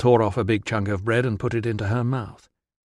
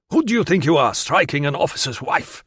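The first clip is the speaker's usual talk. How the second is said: angry guard; 5 semitones higher than usual. Acted. Guard berates the prisoner he caught, furious shout. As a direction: tear into the prisoner for striking the lady.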